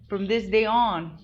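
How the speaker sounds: slow, emphatic declaration; declared out loud. Retold or imitated. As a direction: proclaim it like a resolution. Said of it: "I just fucking said out loud".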